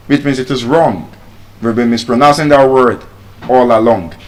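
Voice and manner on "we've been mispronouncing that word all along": ordinary delivery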